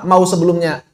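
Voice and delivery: ordinary talk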